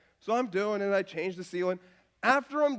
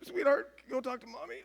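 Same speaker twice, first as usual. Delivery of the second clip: choked up; 3 semitones higher, 5 dB quieter than usual. Retold or imitated. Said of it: "I'm like".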